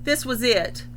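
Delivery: plain reading voice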